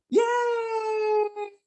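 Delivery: very high, long gleeful squeal; child's cheer. Retold or imitated. Child cheering as the plane rises and drops, squealing. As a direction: imitate a kid on a roller coaster. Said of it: "di belakang ada suara gini".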